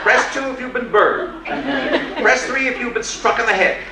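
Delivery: cheerful robot listing emergencies; automated voice. Retold or imitated. Emergency room recording options.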